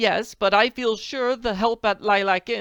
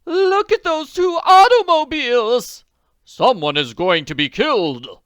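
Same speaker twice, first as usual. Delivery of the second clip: very high, loud alarmed shout; cry of alarm; 13 semitones higher, 13 dB louder than usual. Acted. Woman sees cars about to crash, alarmed shout. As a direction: scream a warning at the crash. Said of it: "gave a cry of alarm".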